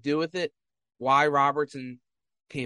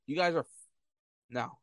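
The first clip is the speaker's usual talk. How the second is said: usual speaking voice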